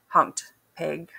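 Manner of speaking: ordinary delivery, nothing marked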